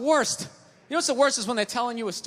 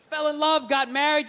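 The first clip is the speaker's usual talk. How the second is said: normal talking voice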